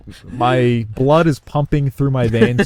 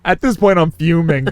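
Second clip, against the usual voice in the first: ordinary talk, nothing marked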